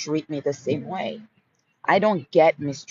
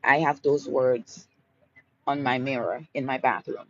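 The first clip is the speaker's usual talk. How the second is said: normal talking voice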